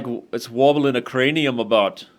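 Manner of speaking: put-on hammy Scottish accent, movie quote; overdone Scottish accent, comic; bad Scottish accent. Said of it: "that was a really bad Scottish accent".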